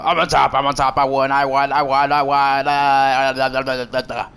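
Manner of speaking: loud, whiny gloating rival; mocking rival impression. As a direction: mimic the rival's loud boasting. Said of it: "That's how he sounds".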